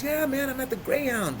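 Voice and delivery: high, pumped-up reply to a buddy; hyped phone answer. Retold or imitated. Telling his friend where he is, excited. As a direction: act out an eager phone answer.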